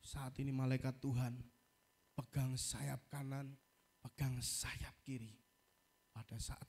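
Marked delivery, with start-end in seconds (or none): 0.0-5.4 slow, soft commanding prayer; quiet prayer. Retold or imitated. Preacher retelling his mid-air prayer, slow quiet command to angels. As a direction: pray it slowly under your breath. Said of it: "Saya berdoa".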